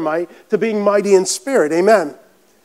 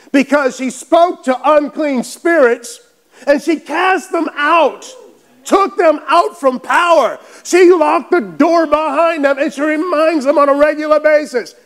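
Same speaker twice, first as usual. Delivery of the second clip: fired-up preaching; 6 semitones higher than usual. Real, not acted. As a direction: preach it at a raised pitch, stretching the words. Preaching with rising intensity.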